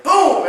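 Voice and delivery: sudden loud, high boom; imitated boom. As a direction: punch out a big boom for the release. Mimicking the adjustment releasing.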